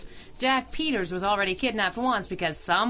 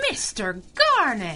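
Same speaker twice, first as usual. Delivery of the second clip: sharp rebuke; 2 semitones higher, 4 dB louder than usual. Acted. Squirrel cuts off rude question.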